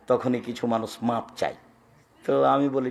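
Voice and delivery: ordinary talk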